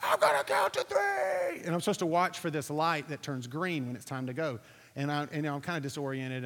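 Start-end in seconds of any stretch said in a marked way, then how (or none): 0.0-1.6 much higher, full shout over the wind; retold shout. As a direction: yell it so he hears through the roaring air. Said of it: "he's screaming".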